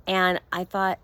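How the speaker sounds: ordinary delivery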